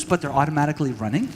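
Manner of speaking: ordinary talk, nothing marked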